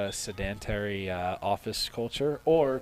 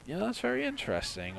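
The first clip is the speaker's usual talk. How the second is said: quick, much higher mock-intrigued reply; high-pitched polite interest; 12 semitones higher, faster than usual. Traveler picks up his retold story again, quick high-pitched interest.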